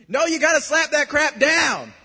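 high, loud preaching shout; forceful exhortation. Real, not acted. Preacher urging congregation to fight off the devil's lies, shouted forcefully. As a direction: cry it out as an urgent order.

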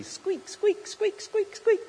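high squeaky imitation of a rusty pump; a squeaking pump, voiced up high. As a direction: squeak it out like an old pump handle. Pump squeaking in retold story.

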